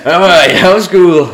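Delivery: very high, loud and drawn out slow; Paul Scholes impression. As Paul Scholes asking his daughter about school, slow high mock voice. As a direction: stretch the cheery question out slowly, way up high. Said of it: "great Paul Scholes impression".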